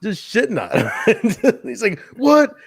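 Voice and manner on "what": high, loud startled what; mock confused. Host voicing Siaki's startled reaction, high and loud. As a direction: jolt up in confusion.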